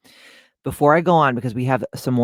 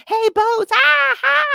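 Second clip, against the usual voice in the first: shrill high frantic squeal, far higher; mock panic; 6 dB louder, slower than usual. Lighthouse panicking at the boats, hammed-up high frantic yelp. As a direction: play a lighthouse losing it, hammed-up alarm. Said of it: "It's having a fucking anxiety attack".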